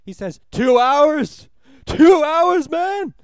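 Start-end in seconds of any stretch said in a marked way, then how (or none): 0.5-1.5 big shouted question, much higher and louder; retold shout. Jason Schwartzman's character shouting out at the end. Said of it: "Jason Schwartzman shouts twice". 1.9-3.1 loud high yell, dragging out man; shouted again. Retold or imitated. Character repeating his shout at the end of the film. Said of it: "He s-, says this twice".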